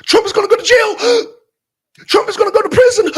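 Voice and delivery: high, loud, breathless panic; gasping like a hysteric hearing bad news; mock panicked gasp. Mocking fans who gasp that Trump is going to jail.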